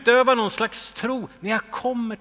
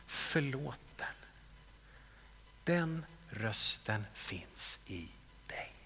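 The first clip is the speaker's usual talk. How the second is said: hushed, unvoiced, lower and much softer; whisper; 7 semitones lower, 15 dB quieter, slower than usual. Preacher whispering the Holy Spirit's voice to the congregation. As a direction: breathe each word like a secret blessing. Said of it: "som viskar".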